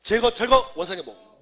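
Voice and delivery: 크고 높게 외치는 암기 구호; 단어마다 힘껏 끊어서; 구호처럼 복창. Shown as a demonstration. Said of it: "한번 더 해보세요". (힘찬 복창)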